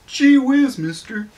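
put-on high, eager gee-whiz kid; lightly voiced wide-eyed 1950s kid; wholesome 1950s kid. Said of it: "My favorite's".

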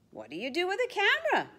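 high, fast curious squeak; curious penguins. Acted. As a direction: squeak the question eagerly. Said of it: "the other penguins ask".

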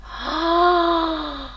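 high drawn-out gasp of wonder; long amazed gasp. Real, not acted. Vlogger seeing the glow, amazed gasp. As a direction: gasp slowly in delight. Marvels at the highlighter.

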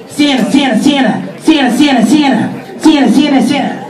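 put-on high, rapid repeated kid call; excited little boy. Little Johnny running out to Santa, high eager calling. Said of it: "little Johnny comes running out".

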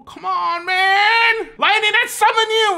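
high, loud cry building to a summons; mock desperate shout. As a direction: yell dramatically at the sky for lightning. Acts man summoning lightning.

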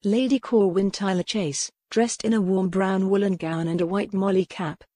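ordinary delivery

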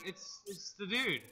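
normal talking voice

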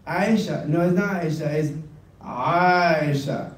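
ordinary talk, nothing marked

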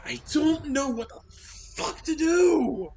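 high, loud, slow despairing yell; real exasperated yell; exasperated outburst. Real, not acted. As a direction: throw up your hands in rage.

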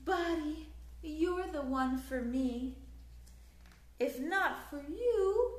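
plain reading voice

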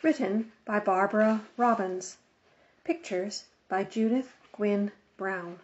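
ordinary delivery, nothing marked

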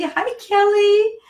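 high, delighted squeal of hello; excited greeting. Real, not acted. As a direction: greet a friend with sudden delight. Greets new client arriving live.

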